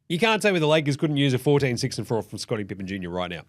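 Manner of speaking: ordinary delivery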